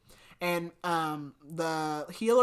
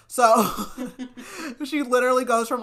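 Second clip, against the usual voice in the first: real laugh breaking into loud, higher speech; laughing while talking; 7 semitones higher, 8 dB louder than usual. Real, not acted. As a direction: crack up mid-sentence and push on. Cracks up at her own joke.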